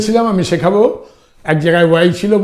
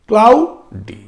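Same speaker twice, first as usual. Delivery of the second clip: Cloudy with a raised klau, pause, then di; split into syllables; 5 semitones higher, 3 dB louder, much slower than usual. Shown as a demonstration. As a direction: separate the two beats of cloudy. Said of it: "আর দুটো যদি হয়".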